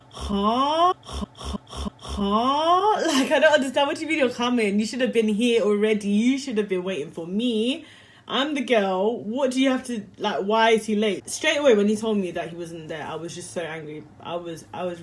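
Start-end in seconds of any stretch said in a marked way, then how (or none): 0.1-3.1 high, loud mock confused huh; mock baffled huh. Retelling her confused reaction to the date, mock baffled. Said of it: "I was like". 3.1-11.3 louder, higher indignant rant; mock indignant. Retelling her complaint about the late date, mock indignant. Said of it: "I was like".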